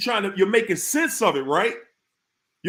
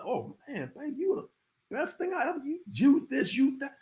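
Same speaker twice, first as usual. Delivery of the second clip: higher, softer, a gushing mock flattery; mock love-bombing sweet talk; 6 semitones higher, 6 dB quieter than usual. Narcissist partner love-bombing the listener, mock gushing softly and higher.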